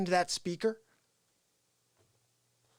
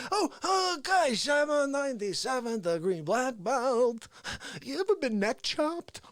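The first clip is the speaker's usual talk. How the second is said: mimicking Face; 4 semitones higher than usual. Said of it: "He's always talking about it".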